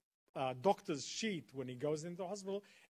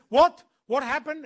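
very loud, high-pitched "what?!"; explosive, high mock shriek of a question; retold urgent demand; 10 semitones higher, 17 dB louder than usual. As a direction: imitate her grabbing the phone and demanding news. Helen grabs phone, demands news.

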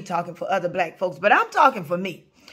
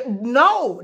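ordinary talk

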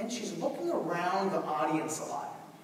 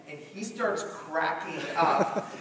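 ordinary talk, nothing marked

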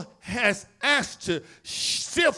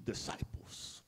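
breathy whisper; Jesus whispering to disciples; 18 dB quieter, slower than usual. Acted. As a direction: drop to a whisper on the word.

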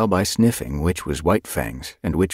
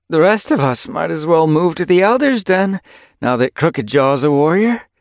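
teasing; 11 semitones higher, 5 dB louder than usual. A narrator's reading. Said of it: "she teased".

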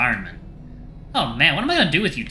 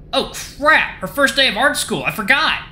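high-pitched, sudden alarm; dismayed realisation; 11 semitones higher, 4 dB louder than usual. Acted. Friend remembering a missed first day, alarmed outburst. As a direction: blurt it out, pitch jumping up.

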